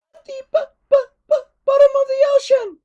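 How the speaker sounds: very high, loud, squeaky dragon voice, stuttering then rushing; stammering little dragon. A narrator's reading. Dragon Toothless speaks.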